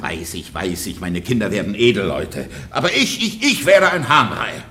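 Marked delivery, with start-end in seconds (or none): 2.7-4.6 bitter laugh. Acted. Husband bemoans being cuckolded.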